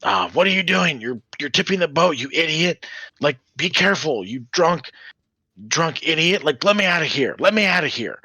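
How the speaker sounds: put-on trapped little girl yelling at a drunk man; little girl screaming. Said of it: "a little girl scream out and say".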